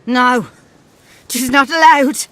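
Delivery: shouted flat refusal; loud refusal. Acted. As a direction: bark the refusal. Gran refuses loudly.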